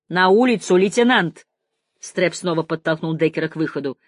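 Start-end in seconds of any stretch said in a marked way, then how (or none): 0.1-1.5 loud, raised command; barked order. A narrator's reading. Captain sends the lieutenant outside, shouting the order. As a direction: order him out forcefully.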